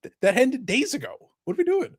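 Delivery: high-pitched, incredulous mock protest; mock disbelief. Host answering an imagined late ballot, high mock disbelief. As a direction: squeak out an exasperated objection. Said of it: "It's like".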